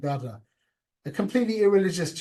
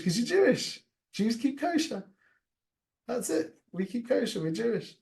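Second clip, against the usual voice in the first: higher, insistent and certain; emphatic rabbi answer; 8 semitones higher than usual. Retold or imitated. Rabbi telling the man why, higher and insistent. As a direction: hit it high, like it's that simple.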